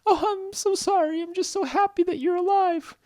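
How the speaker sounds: very high squeaky voice, overflowing; emotional high-pitched squeal; apologetic, relieved Stella. Acted. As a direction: gush it in a tiny high character voice. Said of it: "And she said".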